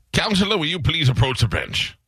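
lightly voiced judge summoning counsel. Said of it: "fast-talking guy from Chicago".

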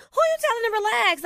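mock indignant comeback, pitched high then settling; riled-up retort. Angry person reacting to being told to relax, mock indignant. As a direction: push back, mildly offended, without shouting. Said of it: "makes the angry person more angrier".

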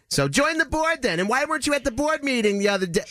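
high, mock whiny complaining voice, rising to a shout; imitating the president's high exasperated rant; mocking shrill board president. [name] mimics the board president snapping at him, high and whiny.

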